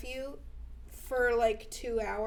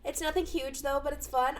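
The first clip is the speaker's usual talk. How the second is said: ordinary delivery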